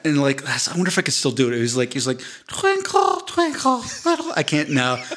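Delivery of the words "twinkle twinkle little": high, far-off bubbly tipsy voice; sing-song like a man full of champagne; bubbly drunk impression. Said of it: "bubbly quality to his voice".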